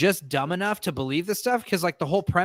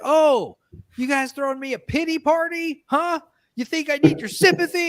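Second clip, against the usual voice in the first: mock tantrum; 10 semitones higher than usual. Actor throwing a tantrum at the birthday party, hammed up. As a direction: throw a fake diva fit.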